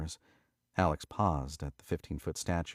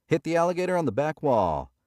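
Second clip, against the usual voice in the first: high, loud dealer call; stickman patter; 10 semitones higher, 8 dB louder than usual. A narrator's reading. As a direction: keep the table hyped.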